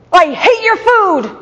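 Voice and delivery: put-on high, slow, loud toddler yell; mock yell of a furious small boy; mimicking a furious toddler who hates her food; angry toddler yell. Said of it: "balled up his fist and yelled at me".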